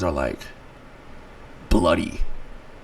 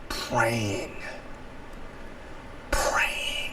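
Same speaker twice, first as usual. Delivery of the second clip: fading whisper; 9 semitones lower, 11 dB quieter than usual. Real, not acted. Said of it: "I was praying".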